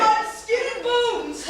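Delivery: ordinary delivery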